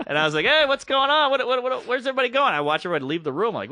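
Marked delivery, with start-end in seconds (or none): 0.4-2.5 high, stammered, puzzled questions; mock bewilderment. Host watching everybody leave the room, high-pitched bewilderment. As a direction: call out confused, pitched well up.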